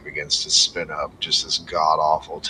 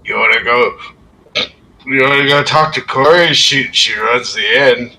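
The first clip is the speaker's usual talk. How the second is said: put-on lightly slurred drunk man, a bit higher; drunk Trovis; 8 semitones higher, 6 dB louder than usual. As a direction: play the tipsy guard inviting everyone along.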